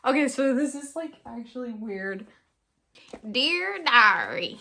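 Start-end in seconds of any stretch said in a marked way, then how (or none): 3.3-4.6 loud, drawn-out title call; show-title announcement. Pretend. Opening her new podcast, drawn-out announcement. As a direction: stretch it out like a show intro.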